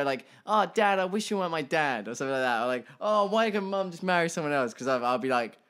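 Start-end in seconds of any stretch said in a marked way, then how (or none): 0.5-2.1 higher, louder mock kid's complaint; whining child. Father imitating his future kids rejecting him, mock childish protest. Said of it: "you know when they go". 3.0-4.7 higher-pitched mock kid complaint; whining child. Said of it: "you know when they go".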